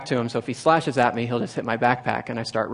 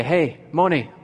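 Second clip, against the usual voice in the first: raised, sing-out New Guinea pidgin call; pidgin English greeting; 4 semitones higher, 3 dB louder, slower than usual. Retold or imitated. Two men meeting the newcomer, imitated pidgin English call. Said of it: "a pidgin English".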